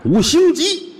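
high voice. Acted. Crosstalk, hammed-up boast.

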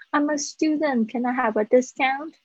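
cute student asking. Retold or imitated. Imitating young-looking shoppers asking for a student discount, cute and high. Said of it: "我觉得蛮可爱的".

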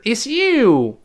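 you said high and slow; It's you with full stressed you. Shown as a demonstration. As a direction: lean on you, slowly. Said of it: "我们重读时".

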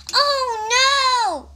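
high, wailed storybook dismay; exaggerated, high-pitched alarm; dismayed cry. A narrator's reading. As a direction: wail it as a worried toad. Toad hears of the monster.

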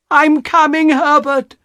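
high, loud call to her son. A narrator's reading. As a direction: call eagerly through the house. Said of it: "she called".

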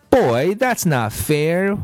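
high, slow mild whine; sulky little pigtail whine; whining. A narrator's reading. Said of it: "whined the pigtail".

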